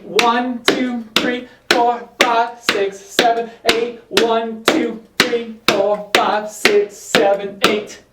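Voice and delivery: rhythmic count called to the beat; counting dance beats. Shown as a demonstration. Counting beats for tap drill.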